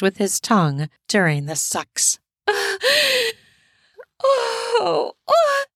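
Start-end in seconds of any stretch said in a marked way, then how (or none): 2.5-3.3 high pleased laugh; high breathy laugh. A narrator's reading. She moans with pleasure. 4.2-5.6 high pleasured moans; moaning oh. A narrator's reading. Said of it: "as she moans".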